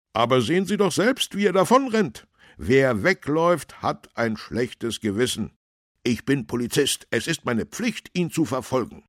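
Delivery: high, loud, insistent officer; urgent policeman. A narrator's reading. Policeman justifies chasing the runaway, urgent and loud.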